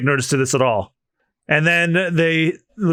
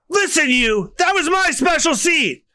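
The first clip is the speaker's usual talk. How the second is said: angry Luffy; 12 semitones higher than usual. Acted. Luffy angry about his seat.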